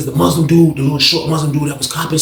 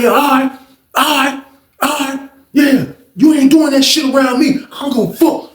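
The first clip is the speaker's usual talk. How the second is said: angry retort; 8 semitones higher than usual. Retold or imitated. Quarrel continues.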